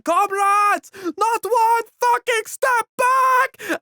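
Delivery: very high, slow, full-force yell; commandant's battle cry. Retold or imitated. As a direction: scream the order at the whole army. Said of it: "commandant being like".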